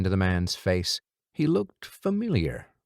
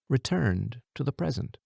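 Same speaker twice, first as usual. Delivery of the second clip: ordinary delivery, nothing marked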